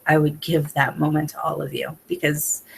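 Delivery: ordinary talk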